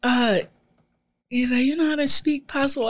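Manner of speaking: high, hammed-up Bajan dad quizzing; dad's island accent. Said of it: "My dad was like".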